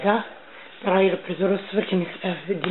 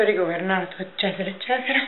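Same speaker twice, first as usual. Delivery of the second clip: ordinary talk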